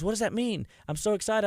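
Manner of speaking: a hint of his wife beaming; husband imitating his proud wife; lightly imitating excited wife. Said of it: "she goes".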